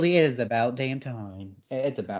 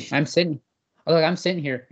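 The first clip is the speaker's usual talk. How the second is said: ordinary talk, nothing marked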